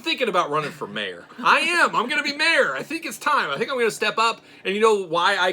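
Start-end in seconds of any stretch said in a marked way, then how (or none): 1.4-5.5 higher, louder proud declaration; grand announcement. Pretend. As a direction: announce it like a candidate. Mock mayoral announcement.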